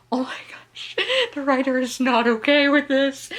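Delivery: half-whispered gasp into a laugh; laughing in disbelief. Retold or imitated. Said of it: "and I'm like".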